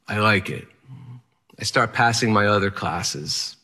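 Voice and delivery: ordinary delivery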